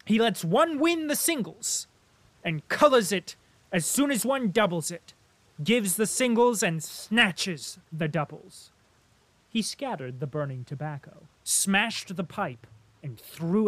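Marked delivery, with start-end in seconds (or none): 0.0-8.7 loud, high ranting complaint; angry shout. A narrator's reading. Denisov shouts his grievance about cards to Rustov. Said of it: "continued to shout".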